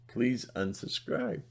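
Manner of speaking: normal talking voice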